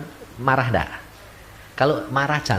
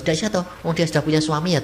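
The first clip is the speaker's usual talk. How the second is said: normal talking voice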